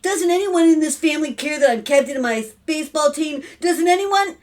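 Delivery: put-on high, loud boyish protest; indignant son. A narrator's reading. Son interrupts about baseball team, indignant child. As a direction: protest like an ignored kid.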